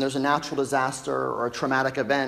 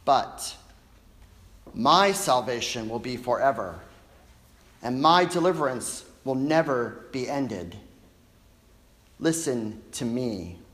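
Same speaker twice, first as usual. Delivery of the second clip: lowered, deliberate close of a proclamation; grave scripture recital, easing down to a slow finish; solemn prophetic assurance; 3 semitones lower, slower than usual. Acted. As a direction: let each phrase settle with long pauses.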